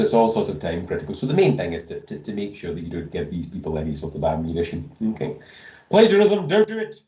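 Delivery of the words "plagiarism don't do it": sudden high, loud mock-stern warning. Lecturer booming a warning to students, high and loud. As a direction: bark it out as a big dramatic warning. Lecturer warns class about plagiarism.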